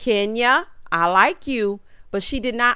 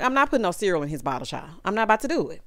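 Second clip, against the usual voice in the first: brisk refusal; much faster than usual. Retold or imitated. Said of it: "I'm like".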